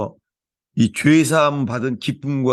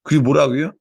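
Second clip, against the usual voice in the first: ordinary talk, nothing marked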